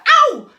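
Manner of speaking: sudden loud, high "ow"; sharp shout of pain; pained yelp. Acted. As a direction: yelp as if clawed by a cat. Jack scratched, lets go.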